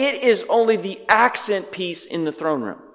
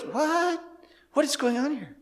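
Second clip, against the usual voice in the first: very high, softer mock disbelief; baffled; 10 semitones higher, 7 dB quieter than usual. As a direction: act confounded, pitched way up. Mock bafflement at a strange vision.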